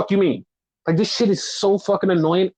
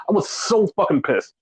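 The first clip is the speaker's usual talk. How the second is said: ordinary delivery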